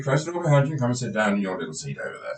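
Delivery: normal talking voice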